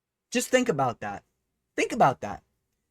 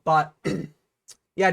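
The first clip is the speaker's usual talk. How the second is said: ordinary talk, nothing marked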